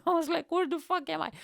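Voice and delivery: high, laughing mock disbelief; laughing high-pitched what-the-hell; mock shocked. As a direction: squeak it out like you can't believe where you are.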